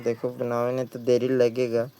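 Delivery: ordinary delivery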